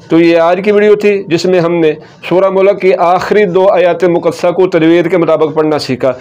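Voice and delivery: ordinary talk, nothing marked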